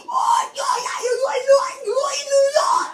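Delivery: loud, high, rapid mock shouting; excited uncle. Uncle thrilled about New York, high excited shout. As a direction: imitate an overexcited uncle crying out.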